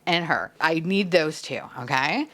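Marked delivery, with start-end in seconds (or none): none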